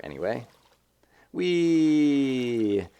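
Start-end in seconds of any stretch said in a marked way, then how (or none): 1.3-2.9 a long, high, loud falling "Whee!"; gleeful whee. Real, not acted. Dropping off the ledge in feather boots, a loud gleeful whee. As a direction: sail down with a loud delighted whee.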